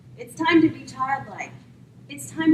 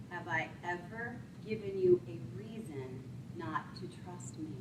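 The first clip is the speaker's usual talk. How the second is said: much softer, fading into breath; gentle donkey; 15 dB quieter than usual. Acted. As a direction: ask it tenderly, almost whispered. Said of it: "this is what the donkey says".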